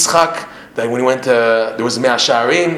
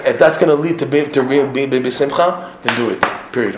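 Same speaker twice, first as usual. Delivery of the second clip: ordinary talk, nothing marked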